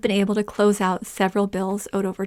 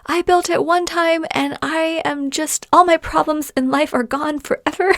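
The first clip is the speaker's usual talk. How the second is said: mock overexcited; 7 semitones higher than usual. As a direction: gush like a newbie who thinks it's all fixed.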